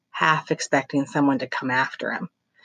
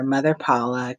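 plain reading voice

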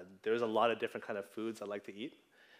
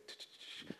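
soft whispered tch-tch clicking, no voice; tongue clicks; 15 dB quieter than usual. Retold or imitated. Pastor mimics the toddler who leads at the mall, clicking tongue.

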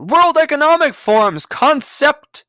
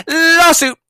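even louder, higher shout; louder emphatic; 9 semitones higher, 6 dB louder than usual. Real, not acted. To companies forcing masks, louder emphatic shout. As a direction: drive it home with a bigger shout.

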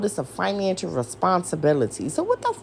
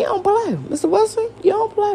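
mock sing-song; 13 semitones higher than usual. Mocking the attorney general Wilson.

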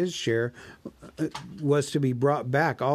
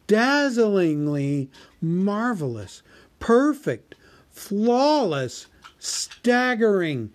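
much higher and louder, slow gush of praise; gushing exclamation; 9 semitones higher, 4 dB louder, much slower than usual. Acted. Bilbo gushes fake praise at the dragon's armor. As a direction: overdo the flattery, wide-eyed. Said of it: "exclaimed Bilbo aloud".

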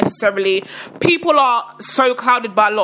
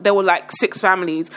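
ordinary delivery

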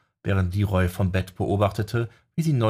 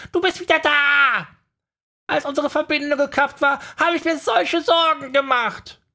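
very high, louder little owl voice, relieved; worried little owl; 5 dB louder than usual. Acted. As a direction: chirp it like a small owl full of worry. Owl character voice held.

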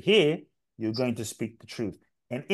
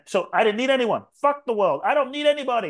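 higher and louder, defiant; 9 semitones higher, 4 dB louder than usual. Retold or imitated. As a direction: spit it out in defiance. Defiant hurt inner voice.

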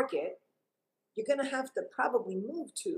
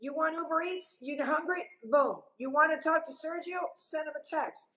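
raised, breezy mock of entitled kids; mocking kids today; 9 semitones higher than usual. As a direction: rattle off easy answers like a know-it-all. Mocking instant gratification.

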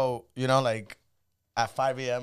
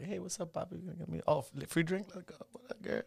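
flirty club voice; 3 semitones higher, 9 dB quieter than usual. Retold or imitated. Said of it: "I pull up to Boogies like".

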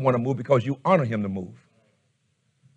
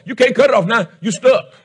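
ordinary delivery

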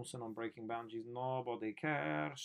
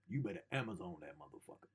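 ordinary delivery

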